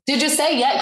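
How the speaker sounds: high, eager mimicked crowd; excited question. Restaurant tourists asking about the proposal, excited and high. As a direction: imitate onlookers bursting to know.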